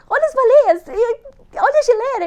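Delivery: thrilled shout. Retold or imitated. Spotting whales from the ferry, ecstatic. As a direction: call out like a child seeing whales. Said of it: "toda empolgada".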